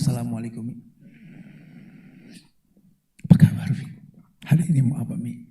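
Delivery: soft, gentle greeting to mother; humble greeting. Retold or imitated. Tough son greets his mother humbly, softly. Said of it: "tapi merendah".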